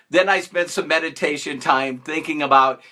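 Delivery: ordinary delivery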